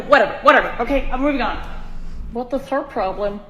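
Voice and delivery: usual speaking voice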